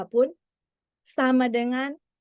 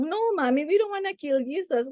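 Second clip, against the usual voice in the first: imitating children; 6 semitones higher than usual. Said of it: "Mereka bilang".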